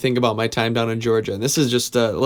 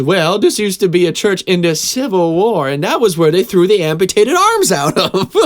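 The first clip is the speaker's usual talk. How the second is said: higher, louder Southern preacher voice with a laugh breaking through; Southern accent; 7 semitones higher, 6 dB louder than usual. Retold or imitated. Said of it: "he had this thick Southern accent".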